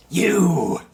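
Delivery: very high, drawn-out shout; creature's yell. Acted. Flying devil to the party that survived, yelled. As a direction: screech it from up in the air. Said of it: "and yells".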